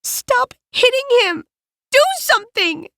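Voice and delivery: put-on high, frantic pleading girl; panicked plea. A narrator's reading. A girl yelling at others to stop the fight, frantic and high. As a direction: beg the boys to stop, voice cracking high.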